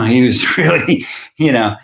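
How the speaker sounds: ordinary talk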